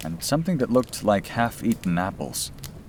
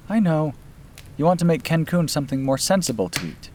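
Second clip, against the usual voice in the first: put-on higher, lightly teasing young woman; young woman's voice; 10 semitones higher than usual. Sister apologizes to brother for her cooking, higher teasing voice. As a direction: voice her apology with a playful edge.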